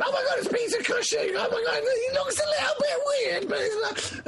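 breathless high-pitched geeky squealing; mock-hysterical fan rattling off details; screaming fan. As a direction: gush in a hammed-up inner scream. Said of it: "Your head is screaming".

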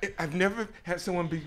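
high, loud burst of smitten delight, easing off; thrilled gushing. Retold or imitated. Caller smitten by the rude British lady, thrilled. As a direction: gush as if floored by that voice.

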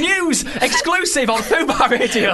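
loud, slow, high announcement; breaking news shout. Acted.

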